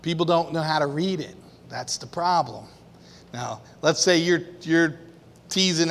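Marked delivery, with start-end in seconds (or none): none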